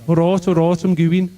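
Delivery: high, loud Brummie accent; Birmingham accent. Shown as a demonstration. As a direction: demonstrate a broad Birmingham accent. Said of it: "If you're from Birmingham, you say, like".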